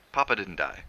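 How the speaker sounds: ordinary delivery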